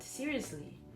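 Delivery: ordinary talk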